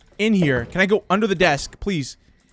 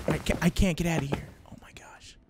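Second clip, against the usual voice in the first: soft, hushed whisper; 3 semitones lower, 10 dB quieter than usual. Real, not acted. Player unable to get out, whispering. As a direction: whisper it under your breath. Still hiding from enemy.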